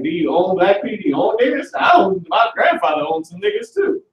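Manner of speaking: high-pitched indignant hillbilly caricature; mock hillbilly. Hillbilly misunderstanding slang answers back, high mock indignation. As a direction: pitch climbs steeply, hammed-up clueless hillbilly. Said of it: "that was a pure hillbilly".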